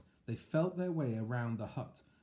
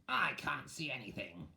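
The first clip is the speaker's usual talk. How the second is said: witch voice; 3 semitones higher than usual. Acted. Witch speaking.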